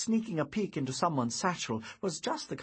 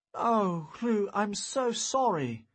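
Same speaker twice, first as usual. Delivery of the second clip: raised, louder, heartfelt apology; apologetic; 8 semitones higher, 4 dB louder, slower than usual. A narrator's reading. Wizard apologizes to the injured boy, apologetic. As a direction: pour out sympathy for a hurt child.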